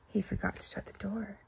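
whispered aside; hushed, unvoiced narration; conspiratorial whisper. A narrator's reading. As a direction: let the kids in on a secret. Secret mischief in the story.

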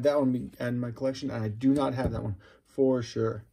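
ordinary talk, nothing marked

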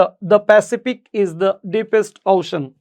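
very slow, each word laid out; slow model reading. Shown as a demonstration. Teacher modelling the corrected sentence, very slow. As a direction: read it out word by word for students. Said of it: "আমরা উচ্চারণটা করব হচ্ছে the".

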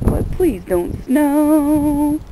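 high, slow, loud plea; drawn-out begging cry; pleading shout. Real, not acted. Begging the weather, cold night.

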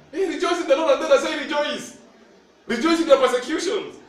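high loud call to rejoice; rally the congregation to rejoice; exhorting. Real, not acted. Preacher calling the church to rejoice in persecution, high and loud.

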